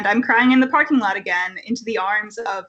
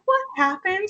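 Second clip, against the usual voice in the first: concerned camp mom; 9 semitones higher than usual. Retold or imitated. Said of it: "And she was like".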